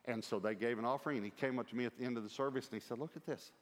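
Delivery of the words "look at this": higher-pitched eager look-at-this; excited, pitched up but not shouted; retold excitement. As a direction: play an excited man showing something off. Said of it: "He was excited".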